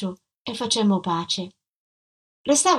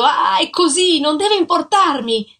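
higher, louder furious cry; shouting; 7 semitones higher, 5 dB louder than usual. Acted. Husband slapping his wife, shouting in fury. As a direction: shout it as you strike.

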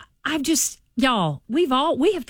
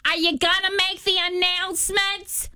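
mocking a rude passenger in a higher put-on voice; imitating a rude woman demanding at the gate, higher and snide; rude lady; 9 semitones higher, 3 dB quieter than usual. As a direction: sneer it like a snippy stranger at the gate. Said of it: "and she is so rude".

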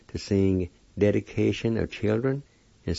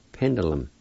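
ordinary talk, nothing marked

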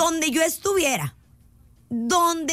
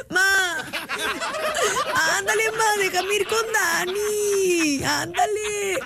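high, drawn-out begging, imitated kid; súplica de niño; 5 semitones higher, slower than usual. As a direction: wheedle mom like a pleading child. Child begging mother to let them go. (ruego infantil agudo y lloroso)

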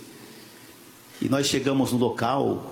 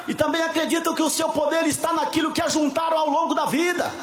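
full-force preaching. Acted. Preacher rousing the church about Samson's strength, full-force preaching. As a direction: preach it at full volume, pressing on without letup.

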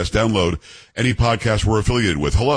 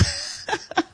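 breathy laugh; 9 dB quieter than usual. Real, not acted. Host laughs at own story.

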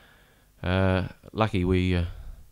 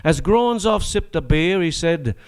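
higher, sing-song reciting voice; comic ballad recitation; 5 semitones higher than usual. Acted. Reciting his comic prawn-war ballad to the audience, sing-song. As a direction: spin the yarn in rhyme for the crowd.

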